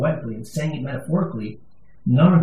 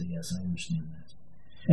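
hushed agreement; 9 semitones lower, 12 dB quieter, slower than usual. Retold or imitated. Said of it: "I say".

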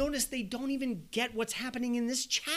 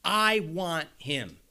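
commanding; 2 semitones lower, 6 dB louder, slower than usual. Retold or imitated. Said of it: "and commands him".

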